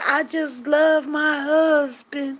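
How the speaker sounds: high, loud, drawn-out gushing; mimicked lovestruck friend. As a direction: stretch it out like a besotted wife.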